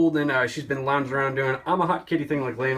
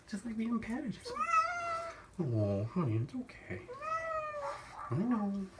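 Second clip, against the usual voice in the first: soothing a scared cat; 11 semitones higher, 6 dB quieter than usual. Real, not acted. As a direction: coax a frightened cat gently. Calming cat in the bath.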